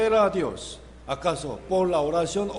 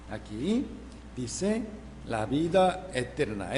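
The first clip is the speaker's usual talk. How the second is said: ordinary talk, nothing marked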